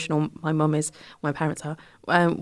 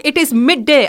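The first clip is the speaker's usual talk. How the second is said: imitating mum; 7 semitones higher, 9 dB louder than usual. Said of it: "It was like".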